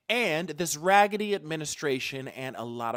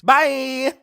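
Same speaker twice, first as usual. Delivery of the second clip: loud cheery bye; 8 semitones higher, 13 dB louder than usual. Real, not acted. Loud sign-off.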